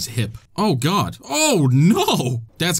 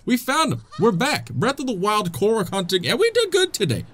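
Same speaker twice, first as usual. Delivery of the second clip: normal talking voice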